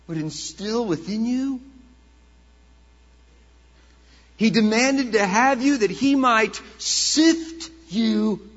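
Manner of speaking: building to loud pulpit intensity; preacher swells on the sifting image; rising preaching. Acted. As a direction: press the weight of it on them.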